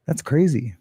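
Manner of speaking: ordinary delivery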